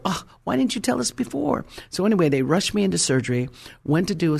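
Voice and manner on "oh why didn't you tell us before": high, startled question; high surprised doctor. Retold or imitated. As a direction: voice the doctors' shock, pitched up. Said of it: "And they go".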